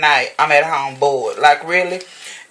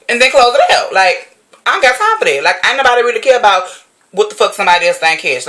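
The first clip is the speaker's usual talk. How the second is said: ordinary talk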